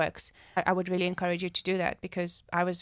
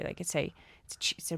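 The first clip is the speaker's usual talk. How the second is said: whispering; 7 dB quieter, faster than usual. Real, not acted. Whispers the echo chamber image.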